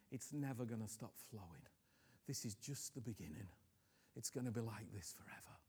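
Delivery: soft, low voice speaking as Jesus; hushed, tender voice of Christ's vow; quiet sermon in Christ's voice; quiet preaching as Jesus. Acted.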